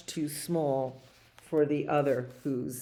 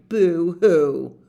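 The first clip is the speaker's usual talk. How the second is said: mock boo hoo; 9 dB louder than usual. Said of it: "will all cry".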